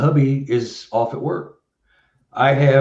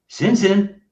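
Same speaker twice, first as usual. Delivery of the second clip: called name; 10 semitones higher than usual. Retold or imitated. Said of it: "like Sin Sin".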